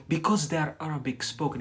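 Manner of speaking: ordinary talk, nothing marked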